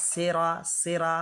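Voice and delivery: সেরা said with thin s, "seraa"; mispronounced সেরা, light s; wrong light sin. Shown as a demonstration. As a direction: say সেরা with e and open final vowel. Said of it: "তাহলে সিন হয়ে গেল".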